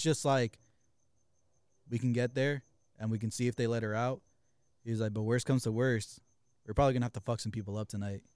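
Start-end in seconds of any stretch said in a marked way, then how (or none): 1.9-4.2 imitated calm, pitched low; super calm. Driver explaining the plan to the passengers, super calm. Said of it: "like, super calm". 5.1-8.2 imitating a friend's low, calm voice; super calm. Driver warning the passengers about tonight, super calm. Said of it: "like, super calm".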